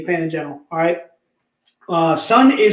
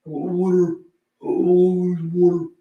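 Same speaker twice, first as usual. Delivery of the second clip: put-on Philly accent; 4 semitones lower than usual. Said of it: "as Dave Franchini would say".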